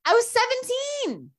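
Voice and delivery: high, drawn-out disbelief; real indignant amazement; incredulous protest. Real, not acted. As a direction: exclaim it way up high, outraged at the absurdity. Disbelief at surgery verdict.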